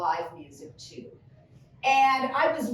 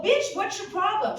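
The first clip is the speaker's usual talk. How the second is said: confrontational; 8 semitones higher than usual. Retold or imitated. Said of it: "I go".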